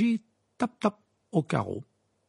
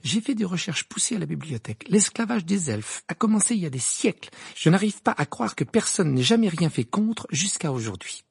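put-on higher, earnest activist girl; indignant Hermione; 6 semitones higher than usual. A narrator's reading. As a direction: press the injustice with conviction. (voix d'Hermione, indignée)

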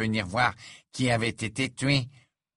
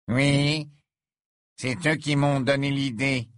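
crooked smirk; 5 semitones higher, 3 dB louder, slower than usual. Acted. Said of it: "dit Malfoy avec un sourire de travers".

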